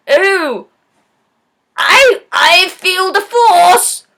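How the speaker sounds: loud, drawn-out mock proclamation; blind guy's catchphrase. Blind guy announcing his catch line, loud slow mock shout. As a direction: belt it out slowly as a hammed-up forced line. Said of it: "he's like".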